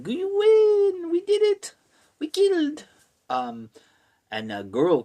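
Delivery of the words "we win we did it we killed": very high, loud squealing cows; mock triumph. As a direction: celebrate in a shrieky falsetto. Cows celebrate in retold story.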